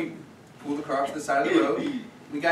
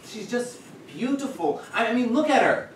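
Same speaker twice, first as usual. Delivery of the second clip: lovestruck gushing; 7 semitones higher than usual. Acted. Storyteller showing off his first love to the audience, lovestruck. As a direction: gush with helpless admiration, building to an outburst.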